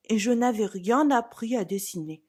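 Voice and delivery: plain reading voice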